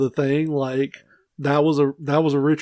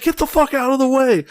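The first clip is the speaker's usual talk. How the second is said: much higher mock yell; honking yell; 11 semitones higher than usual. Driver yelling at an extra blocking the car, much higher mock shout. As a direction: shout him out of the road. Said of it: "I just laid on the horn and was like".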